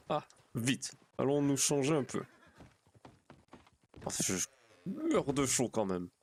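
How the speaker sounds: lightly voiced roleplay character. Streamer back in his role, hot and changing clothes, lightly in character. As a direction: slip lightly into character, pitch nudged up. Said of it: "Je retourne dans mon personnage".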